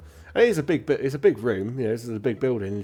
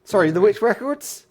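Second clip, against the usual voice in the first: higher, louder, playful clerk voice; chirpy records clerk; 11 semitones higher, 11 dB louder than usual. Acted. Clerk joking with visitors asking for death records, bubbly. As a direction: greet them with a running joke, high and loud.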